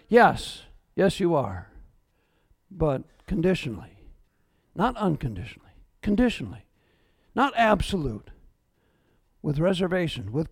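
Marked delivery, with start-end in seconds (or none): none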